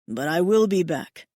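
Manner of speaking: a low, husky promise; low husky mate's reassurance. A narrator's reading. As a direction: lean in and murmur it low and husky. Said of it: "he says in a low, husky voice".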